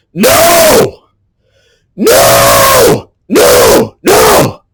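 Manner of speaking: loud repeated shouted no's, some breaking; real raw cathartic yells; release shouts. Real, not acted. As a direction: keep hammering each no out.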